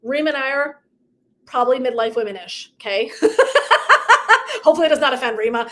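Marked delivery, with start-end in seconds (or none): 3.1-4.6 sudden high laugh; high burst of laughter. Real, not acted. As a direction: let a bright laugh escape. Laughs after joke about age.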